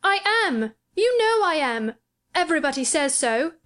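put-on high, furious boy; indignant insistence. A narrator's reading. Sick boy insists he is dying, high and indignant. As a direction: insist like an affronted child. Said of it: "at once furious and slightly pleased".

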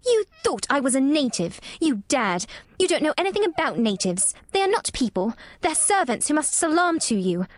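put-on high raging spoilt girl. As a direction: lash out in rage. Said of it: "her rage and humiliation".

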